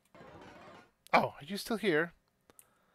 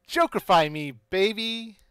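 ordinary talk

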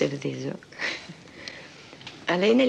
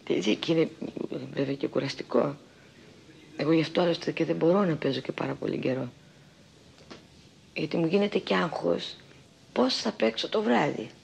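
normal talking voice

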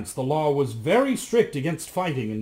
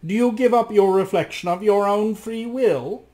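crafty magician; 8 semitones higher, 7 dB louder than usual. Acted. The magician slyly asks Rasmus for his reflection. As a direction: demand it smoothly, like a scheming sorcerer.

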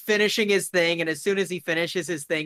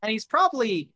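ordinary talk

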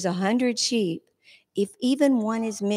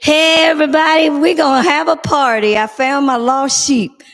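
louder, higher joyful call to everyone; mock holler; 5 semitones higher, 7 dB louder than usual. Jesus calls everyone to celebrate his found sheep, joyful holler. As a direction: announce the party like calling a crowd. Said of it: "he'd have hollered and said".

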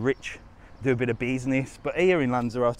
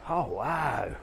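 usual speaking voice

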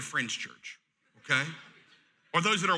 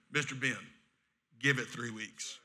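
calm reassurance; 9 semitones lower, 7 dB quieter than usual. Retold or imitated. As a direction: settle him down gently. Calm advice to angry man.

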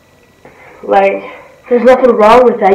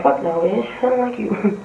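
muttered brush-off; 2 semitones lower, 10 dB quieter than usual. Pretend. Dismissive retort to SpongeBob.

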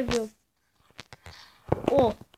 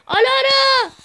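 loud high call of a name; 10 semitones higher, 8 dB louder, slower than usual. Real, not acted. Gamer calling teammate Alara loudly. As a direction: shout her name across the map.

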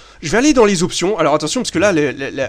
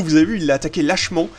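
normal talking voice

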